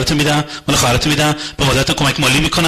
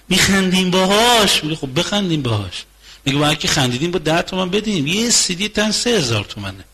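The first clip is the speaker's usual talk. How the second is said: ordinary delivery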